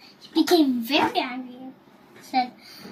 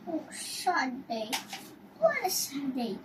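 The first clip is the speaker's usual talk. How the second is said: soft, sad murmur to himself; 9 dB quieter than usual. A narrator's reading. Sad man murmuring alone on his walk, soft murmur. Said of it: "say, murmuring".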